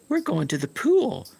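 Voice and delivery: high put-on voice of a friend; prissy high imitation of pool kids; mock friends. Friends announcing the pool, high put-on voice.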